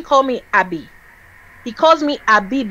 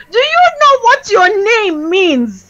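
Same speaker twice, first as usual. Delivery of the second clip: high, drawn-out disbelief; exasperated outcry; 8 semitones higher, 4 dB louder, slower than usual. Retold or imitated. Scolding someone for bastardizing their name, exasperated outcry. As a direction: wail it slowly in mock pain.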